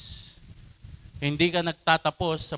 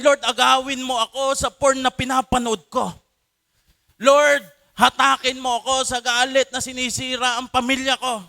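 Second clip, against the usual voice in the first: higher, louder pleading prayer; a loud, urgent string of pleas; desperate cry; 6 semitones higher, 4 dB louder than usual. Shown as a demonstration. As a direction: plead as if drowning. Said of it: "desperadong tawag".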